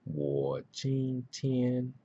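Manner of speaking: careful, halting Mandarin tones, "jin tian" held high; learner sounding out tones. Shown as a demonstration. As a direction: piece together "Wo, jin tian" tone by tone. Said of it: "this is how you're gonna be".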